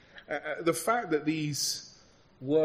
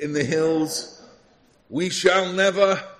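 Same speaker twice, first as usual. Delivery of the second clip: put-on Winston Churchill accent; 5 semitones higher, 9 dB louder than usual. Preacher cueing congregation to finish the line, booming imitation statesman's accent. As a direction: swell to a ringing mock wartime-leader accent, leaving it hanging. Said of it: "this accent, is it?".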